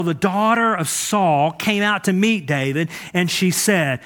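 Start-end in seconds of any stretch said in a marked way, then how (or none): none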